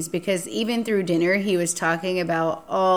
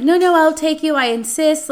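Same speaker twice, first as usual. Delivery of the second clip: much higher, louder insisting; pushy insistence; 8 semitones higher, 7 dB louder than usual. Retold or imitated. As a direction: refuse to let her leave. Date insists on driving her.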